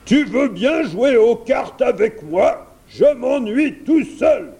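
loud, high dragon voice; pleading dragon. A narrator's reading. Dragon asks the prince to play cards, pleading. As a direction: beg for company as a bored dragon.

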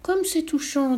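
high, drawn-out tender exclamation; acted emotion in a lifted voice; deeply touched. A narrator's reading. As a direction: coo it as if moved. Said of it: "le pape fut tout ému".

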